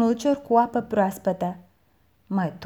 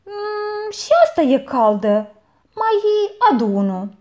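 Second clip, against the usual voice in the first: louder, cross order; aunt snaps at the boy for more water; snapping aunt; 10 semitones higher, 6 dB louder than usual. A narrator's reading. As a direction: bark for another jug. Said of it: "se răsti mătușa".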